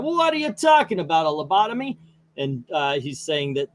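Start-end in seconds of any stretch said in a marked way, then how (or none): 0.0-2.0 high, fast outraged Joker shout; Joker yelling. Acted. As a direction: hurl the question back at the warden. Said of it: "Joker's yelling out".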